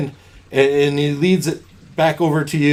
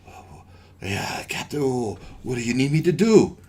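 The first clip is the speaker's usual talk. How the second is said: dazed and breathy; 3 semitones lower, 6 dB quieter than usual. Acted. Dazed man answering captain.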